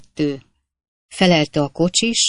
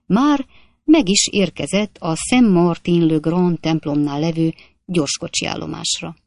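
usual speaking voice

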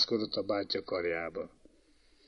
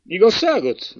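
high, louder questioning exclamation; incredulous echo; 8 semitones higher, 5 dB louder than usual. A narrator's reading. Prince answering two kneeling boys, loud incredulity. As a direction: throw the word back in disbelief.

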